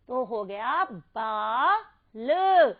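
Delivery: slow, drawn-out बाल at the end; stretched model word. Shown as a demonstration. Teacher blending syllables, slowly stretches बाल. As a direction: draw बाल out long so kids hear it. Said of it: "हमने लिख दिया शब्द ला".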